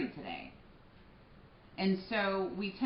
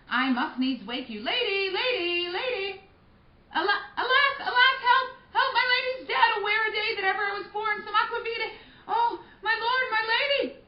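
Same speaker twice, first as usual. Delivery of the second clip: high, loud cries for help; panicked wailing; 13 semitones higher, 9 dB louder than usual. Acted. Nurse finding Juliet dead, shrieking for help. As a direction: shriek in horror at the body.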